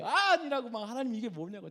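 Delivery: retold indignant outburst; scolding protest. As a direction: protest as if badly wronged. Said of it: "막 뭐라고 하는 거예요".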